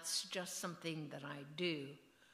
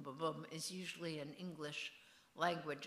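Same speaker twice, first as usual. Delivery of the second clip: ordinary delivery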